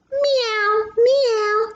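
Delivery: high squeaky meows; cat meow. Acted. Reading to child, meowing like the cat. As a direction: mew like a little cat.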